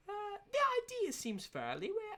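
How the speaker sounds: high, hammed-up British accent; put-on British accent. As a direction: read it in an overdone British accent. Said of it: "I don't know if these people are British".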